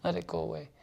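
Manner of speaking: soft, slightly lifted aside; note it very gently and let go; hushed murmur to oneself; detached self-talk. Acted. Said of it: "just say".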